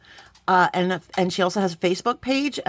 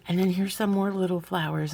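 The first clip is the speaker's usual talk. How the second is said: ordinary talk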